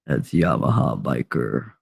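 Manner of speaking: very low, soft croon from the deep; velveteen deep voice. Retold or imitated. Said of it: "the velveteen voice from the deep".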